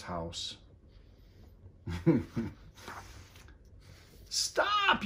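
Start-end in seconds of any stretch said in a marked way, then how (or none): 4.3-5.0 high sharp yell; yelled stop. A narrator's reading. Big Anthony yells at the overflowing pot, high yell. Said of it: "yelled Big Anthony".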